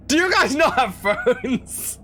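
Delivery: slow, laughing mock question. Mocking the stranger's question to Canadian chat, laughing. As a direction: drag it out through a laugh. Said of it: "repeated as mocking quote".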